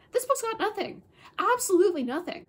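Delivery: loud, high scornful verdict; scornful dismissal. Real, not acted. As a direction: shut the book on it for good. Dismissive verdict in book rant.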